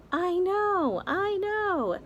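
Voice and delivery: greedy aunt squealing agreement; excited aunt. Acted. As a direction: gush in agreement.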